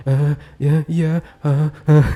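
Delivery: mock high-pitched, hurried nervous stammer; gugup dan cempreng. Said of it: "pasti suaranya kayak gini nih". (suara tiruan cempreng, terbata-bata)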